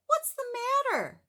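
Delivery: very high, loud, concerned bird; Big Bird voice. Acted. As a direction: squeak it out like a kindly big bird. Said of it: "said Big Bird".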